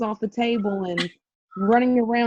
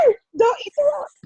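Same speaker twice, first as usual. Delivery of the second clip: put-on mom yelling at toddler. Mom yelling at her son who eats rocks, frantic high shout. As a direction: wail it at the kid like a frantic mom. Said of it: "I was like".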